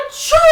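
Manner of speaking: loud high hammed-up sneeze; big sneeze. Bear sneezing from a pepper fleck, loud hammed-up sneeze. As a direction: let out a huge sneeze. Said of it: "makes the bear".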